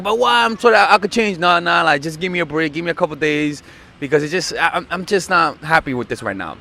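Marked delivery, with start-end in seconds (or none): 0.0-1.4 higher, pleading plea; 1=pleading boyfriend. Retold or imitated. Boyfriend begging not to be dumped, higher pleading. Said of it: "He'd be like".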